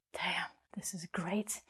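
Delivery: slow, hushed whisper; whispered aside. Real, not acted. Teacher privately cheers her own lesson, whispered aside.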